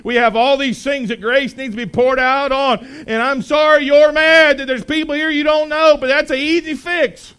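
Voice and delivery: from steady to loud, high exhortation; building preaching. Acted. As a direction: build and build until it peaks.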